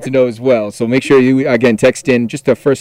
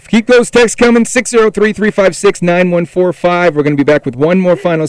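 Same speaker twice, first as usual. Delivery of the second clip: higher, bright radio plug; upbeat announcer; 6 semitones higher, 3 dB louder than usual. Acted. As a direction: sell the number with energy.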